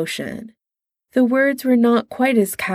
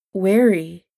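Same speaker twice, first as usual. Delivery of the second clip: ordinary delivery, nothing marked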